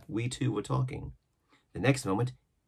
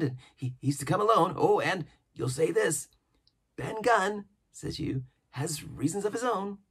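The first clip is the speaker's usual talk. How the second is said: higher-pitched, excitable marooned sailor; an odd, jittery hermit rattling on; eager old castaway; 8 semitones higher than usual. A narrator's reading. Ben Gunn voiced in reading.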